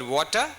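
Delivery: "water" with a crisp T; British "water". Shown as a demonstration. Teacher recommending the good version, "water" with a sounded T. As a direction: pronounce the T in "water" cleanly, British style. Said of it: "is good".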